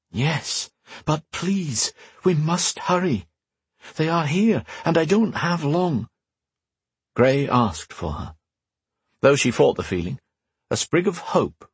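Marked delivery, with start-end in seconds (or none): 0.1-6.1 an urgent voice from afar, kept gentle; mysterious woman voice. Acted. Telepathic woman pleading.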